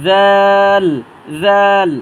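জাল with tongue at tooth tips, held long; drawn three counts. Shown as a demonstration. Teacher models a letter for learners, drawn long. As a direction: stretch the vowel of জাল, loud and level. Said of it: "তিন আলিফ টেনে পড়ব".

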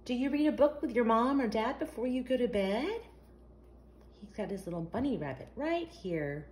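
ordinary delivery

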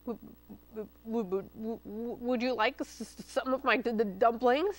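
scared stutter, w w w; stammering. A narrator's reading. Oinky offers dumplings to the fox, terrified stammer. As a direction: stutter in fright. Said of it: "stammered Oinky".

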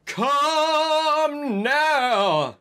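high, drawn-out shrill cry; angry command. A narrator's reading. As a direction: order them out in a stretched high voice. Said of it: "shoot at us angrily".

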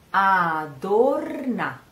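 Adorna said slowly, leaning on the O; slow stressed O. Shown as a demonstration. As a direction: draw out Adorna, weight on the O. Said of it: "The stress of the sentence is on the O".

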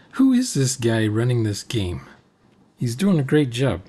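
ordinary talk

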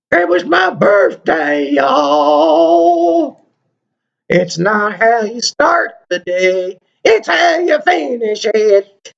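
high, slow sing-song put-on voice. Telling viewers about his birthday, silly high voice.

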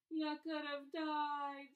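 loud, high, drawn-out acted wail; sobbing. A narrator's reading. Giant visitor blaming himself at Harry's bedside, sobbing. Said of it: "sobbed Hagrid".

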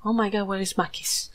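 ordinary delivery

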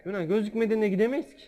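calling out over the chaos; keyed-up yell to the team; excited shout. Real, not acted. Excited shouting during gameplay.

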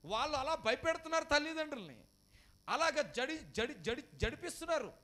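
loud, high, urgent sermon delivery; full-force preaching. Acted. Pastor warning the congregation, loud and high. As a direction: preach it out over the crowd.

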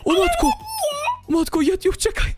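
high scared shouting; frightened cry. Real, not acted. Player cries out to Yeti while being chased, frightened high cry. Fleeing danger.

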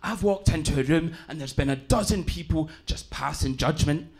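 rough Glaswegian uncle giving earnest advice; Glaswegian accent. Retold or imitated. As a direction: imitate a Glasgow uncle counselling his nephew. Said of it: "like, the roughest of them all".

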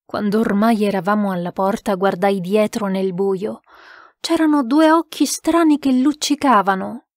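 usual speaking voice

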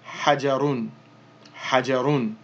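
"hajarun" with the run ending sounded. Shown as a demonstration. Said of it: "ra with the dhammatan".

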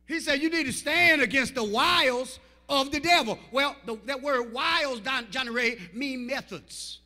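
loud, driving sermon shout; full-force preaching. Acted. Preacher shouting to the church about whining, full force. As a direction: hammer it home to the congregation.

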